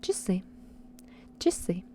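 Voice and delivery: Часы with a short "i"-like first vowel; unstressed reduced A. Shown as a demonstration. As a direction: reduce the A in Часы, stressing the end. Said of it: "When A is not stressed".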